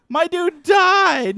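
high, slow stretched-out cry of dismay; dismayed wail. Real, not acted. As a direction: wail as your pet dies. His creature just died.

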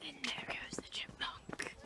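hushed whisper. Real, not acted. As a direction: whisper so you won't scare it. Spotting a chipmunk quietly.

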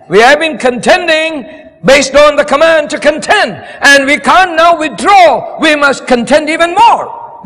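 rally the congregation to keep fighting; fiery preaching. Acted. Preaching at full force.

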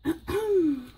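a rough, real throat clear. Real, not acted. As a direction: clear the smoke out of your throat. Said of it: "Excuse me, sorry".

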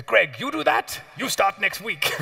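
high, hearty, plummy instructor; a grand, cheerful old explorer's voice; posh old-school voice. Retold or imitated. Greg imitating Q agreeing to train him, posh and hearty. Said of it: "speaks with the most outrageous accent".

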